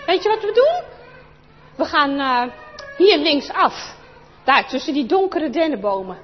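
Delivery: put-on loud, eager boy taking charge; bossy boy. Chris to Anneke, loudly taking charge. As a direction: sound like the kid who knows best.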